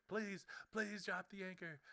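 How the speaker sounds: high, slow, drawn-out begging; imitated child pleading. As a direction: beg like a little kid.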